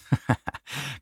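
brief amused chuckle; short laugh. Real, not acted. Host finding his own failure ironic, short light laugh.

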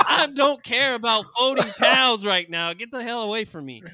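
laughing mock rant at a sleep-talker; mock angry. Woken at night by sleep-talking dorm chief, mock angry rant. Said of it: "I'd be like".

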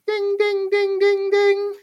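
high, ringing ding on one note; be the desk bell, dinging over and over; imitated reception bell. Game master imitating the bell being struck, dinging. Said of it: "sounds like the reception bell just dinging".

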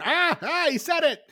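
very high, excited cartoon-dad impression; high-pitched mock excitement at a title drop; Peter Griffin. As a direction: squeal it like a thrilled cartoon character. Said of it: "or the Peter Griffin".

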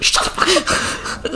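slow, whispered mock reprimand; scolding whisper. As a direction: hush him up under your breath. Retold hushed scolding after punching him.

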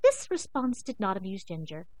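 plain reading voice

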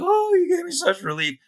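high, sighing relief from the patient; squeaky mock gratitude; relieved patient. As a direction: gush with relief like a grateful patient. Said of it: "the guy was like".

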